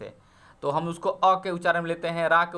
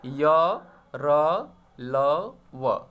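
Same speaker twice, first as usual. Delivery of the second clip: slow drawn-out recital; 2 semitones lower, 3 dB louder, much slower than usual. Shown as a demonstration. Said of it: "अंतस्थ कौन से होते हैं".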